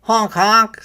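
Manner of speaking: high, loud goose honk; honking geese. A narrator's reading. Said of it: "said the geese".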